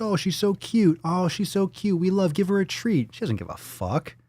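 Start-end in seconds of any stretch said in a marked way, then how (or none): 0.0-3.1 higher-pitched mock gushing; mock adoring coo; gushing admirers. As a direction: imitate people fawning over a cute dog. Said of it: "everyone's like".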